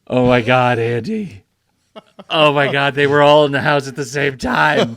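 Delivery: loud astonished realization; shocked. Real, not acted. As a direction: blurt it out in disbelief.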